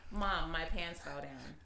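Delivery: put-on slow flat kid voice; deadpan, no alarm at all; lightly voiced deadpan six-year-old; flat deadpan child. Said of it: "in straight, straight tone like that".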